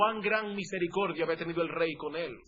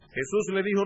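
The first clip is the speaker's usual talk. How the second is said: usual speaking voice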